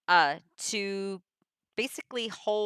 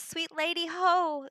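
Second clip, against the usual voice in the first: higher, sugary steward greeting; lightly voiced Malvolio; 9 semitones higher, slower than usual. Malvolio greets his lady Olivia, a higher lightly sweet voice. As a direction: greet her like a smitten steward, pitched up. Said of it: "and he says".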